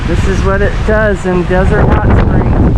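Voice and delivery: loud, high over gusts; shouting over wind. Real, not acted.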